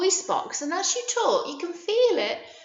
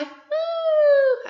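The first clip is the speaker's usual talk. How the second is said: very high, loud, held "ooh"; 6 dB louder than usual. Shown as a demonstration. Teacher showing how high the voices went, high-pitched ooh. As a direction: hoot up at the top of the voice. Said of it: "your voice went high".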